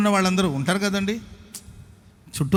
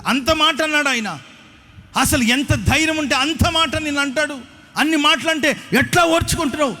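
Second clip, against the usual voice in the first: high, shrieking indignant rant; furious sister; 8 semitones higher than usual. Retold or imitated. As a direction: scold her for putting up with it, pitched way up. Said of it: "గట్టి గట్టిగా అరుస్తుంది ఆమె".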